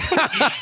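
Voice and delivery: sudden high laugh; high burst of laughter. Real, not acted. So I start laughing.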